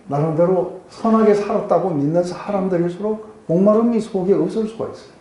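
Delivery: ordinary talk, nothing marked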